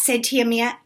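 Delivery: ordinary delivery, nothing marked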